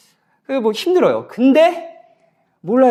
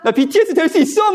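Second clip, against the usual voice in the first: mock boast; 10 semitones higher than usual. Pastor jokingly tells youth he can join BTS, higher and laughing. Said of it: "막 이러니까".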